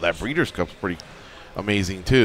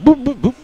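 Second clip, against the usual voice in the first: boop sound effects; 9 dB louder than usual. Retold or imitated. Dodging sounds.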